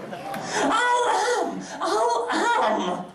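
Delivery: high laughing yell; laughing shout. Acted.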